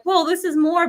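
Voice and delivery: playful mock amazement; mock surprise. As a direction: act taken aback by the extra load. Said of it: "you're like".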